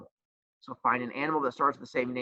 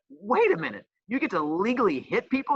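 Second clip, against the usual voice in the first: high, eager surprise building into a question; light hammed-up delight at the idea; mock amazed realization; 7 semitones higher than usual. Teacher retelling learning sports allow hitting, high and eagerly surprised. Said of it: "And I went".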